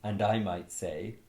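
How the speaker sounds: normal talking voice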